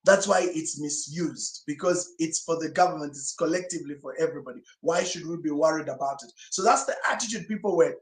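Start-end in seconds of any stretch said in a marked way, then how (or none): none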